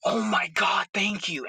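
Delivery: high, excited gush; overjoyed fan gushing, pitched high; hyped excitement. Retold or imitated. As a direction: burst out thrilled at the ending. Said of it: "I was like".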